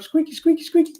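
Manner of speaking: very high squeaky chirps. Pretend. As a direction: squeak it out like a toy. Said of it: "Got some squeakies going up".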